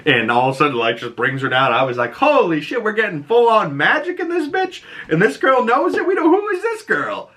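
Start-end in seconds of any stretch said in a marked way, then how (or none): 2.1-7.3 much higher, excited disbelief; stunned excitement. Retold or imitated. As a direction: squeal it like a hyped fan. Her own stunned reaction retold.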